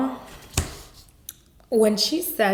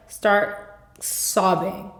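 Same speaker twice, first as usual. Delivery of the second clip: usual speaking voice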